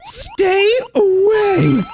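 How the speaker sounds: very high, slow, drawn-out scared yell; panicked scream. Real, not acted. Gamer fleeing a giant squid, panicked drawn-out shriek. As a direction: shriek it at the monster closing in.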